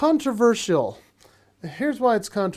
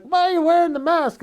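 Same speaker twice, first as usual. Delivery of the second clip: much higher, louder challenging question; mock questioner; 10 semitones higher, 4 dB louder than usual. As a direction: mimic a nosy stranger high. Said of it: "they're like".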